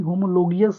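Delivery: "होमोलोगियस" with an i-glide before the final -as; variant pronunciation. Shown as a demonstration. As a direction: say "होमोलोगियस", ending in -gi-yas. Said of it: "भी इसे कुछ लोग पढ़ते हैं".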